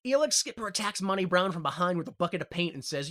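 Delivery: ordinary talk, nothing marked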